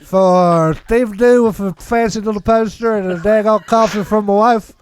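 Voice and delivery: put-on drunk. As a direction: drag it out like a tipsy host. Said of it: "drunk [name]".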